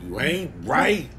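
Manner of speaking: usual speaking voice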